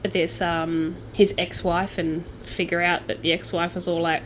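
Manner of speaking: ordinary talk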